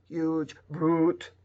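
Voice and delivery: "huge brute" in a stiff robot voice. Shown as a demonstration. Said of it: "And maybe now like a robot".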